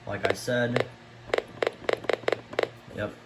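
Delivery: usual speaking voice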